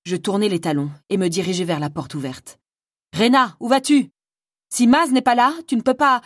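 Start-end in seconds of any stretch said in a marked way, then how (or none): 3.2-6.2 much higher and louder urgent plea; alarmed calling out. Acted. Frima calls after Rena heading out, loud and alarmed. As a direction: shout to stop her at the door.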